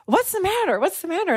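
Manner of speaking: high-pitched imitation of a man just woken; startled sleeper. Snorer woken by women talking over him, startled.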